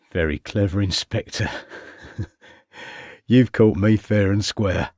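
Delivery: switching into a lightly voiced Cockney accent. A narrator's reading. Caught conman to inspector, Cockney accent with a nervous laugh. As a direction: drop the French, slide into Cockney. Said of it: "speaking in a Cockney accent".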